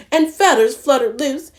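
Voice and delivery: ordinary delivery, nothing marked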